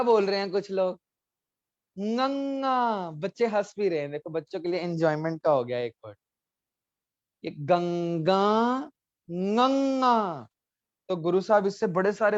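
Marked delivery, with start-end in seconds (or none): 2.0-3.1 गंगा as ŋəŋa, slow and nasal, voice in the nose; nasal sound. Shown as a demonstration. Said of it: "ध्यान से बोलना है". 7.4-10.5 गंगा as ŋəŋa, slow and nasal, voice in the nose; nasal sound. Shown as a demonstration. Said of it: "ये nasal sound है".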